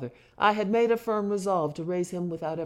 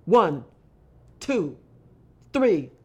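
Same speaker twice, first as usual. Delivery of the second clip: forceful counting; 7 dB louder, much slower than usual. A narrator's reading. Counting the lashes.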